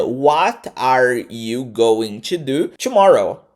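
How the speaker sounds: "What are you going to do tomorrow?" very slow, word by word; careful full pronunciation. Shown as a demonstration. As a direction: model "going to" in full for learners. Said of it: "você pode pronunciar assim".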